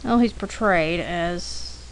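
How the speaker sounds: ordinary talk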